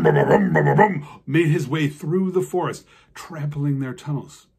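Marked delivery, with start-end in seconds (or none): 0.0-1.1 low booming rum ba ba beat; deep giant footsteps. A narrator's reading. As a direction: thump it out deep and heavy like giant steps. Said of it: "the giant rum".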